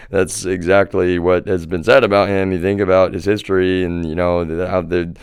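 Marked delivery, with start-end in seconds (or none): none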